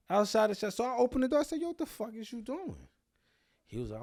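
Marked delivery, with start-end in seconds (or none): 0.1-2.9 high-pitched, hammed-up confrontation; animated retelling. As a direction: shoot the voice way up in comic outrage. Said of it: "I am being a little bit more animated".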